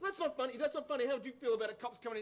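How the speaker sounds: upset protest. Acted. Upset at joke about cops.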